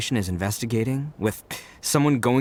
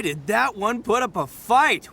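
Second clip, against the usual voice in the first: normal talking voice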